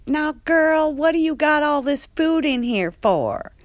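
high, loud, fast sassy scolding; Andre's voice. Retold or imitated. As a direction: staff member scolding T about hoarded food. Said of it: "And Andre's like".

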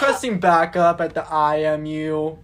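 loud laughing radio call; police radio. Pretend. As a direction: laugh through a backup request on the radio. Pretend walkie-talkie call.

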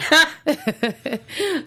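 bright giggling laugh up high; amused high-pitched laugh. Real, not acted. Laughs at doubtful muscles claim.

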